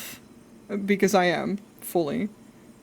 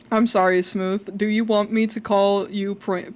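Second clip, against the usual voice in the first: ordinary talk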